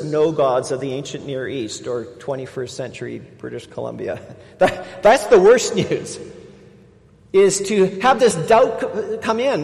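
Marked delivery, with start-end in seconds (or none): 4.6-9.6 raised, slowed sermon emphasis; building preacher's warning; preaching. Acted. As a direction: lean hard on the worst news.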